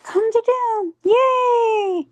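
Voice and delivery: drawn-out, high-pitched little yay; slow down and let the voice soar in celebration; high cheer. Real, not acted. Telling counselor she calmed Jenny down, high celebratory cheer.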